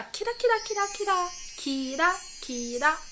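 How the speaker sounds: high, sing-out twinkle; playful twinkling lilt, high; sparkly. Shown as a demonstration. Imitating twinkling for the word sparkle.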